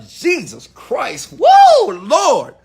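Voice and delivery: high, loud comic exasperation; exasperated exclamation. Real, not acted. Father venting about parenting his daughter, exasperated. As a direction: cry out in overwhelmed exasperation.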